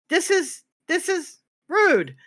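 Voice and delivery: high, slow, mock-offended drawl; offended Nova. Nova sipping blood, hammed-up offended and high. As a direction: act out a prissy affronted huff. Nova's reaction, retold RP scene.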